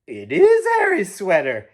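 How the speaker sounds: Grandma realizing with surprise; exclaiming. Acted. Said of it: "exclaimed Grandma".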